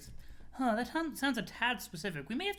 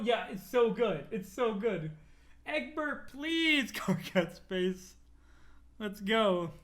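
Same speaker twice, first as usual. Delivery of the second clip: ordinary delivery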